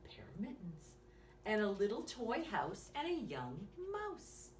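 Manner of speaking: put-on lively bedtime story read to a small child; reading to children. Reading a bedtime story to young listeners, lively and gentle. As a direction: read it like a bedtime picture book. Reads picture book aloud.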